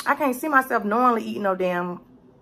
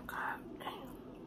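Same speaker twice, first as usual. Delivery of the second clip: a soft whispered oath; whispered curse; 24 dB quieter than usual. Real, not acted. Fighting the chopsticks, she whispers a curse. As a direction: breathe the curse out with no voice.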